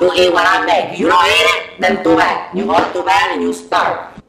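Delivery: deep, loud, laying down the house rules; stern parent voice. Acted. Cook telling picky eaters they eat what he makes, stern deep parent voice. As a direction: growl it like the parent nobody argues with. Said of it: "So I say".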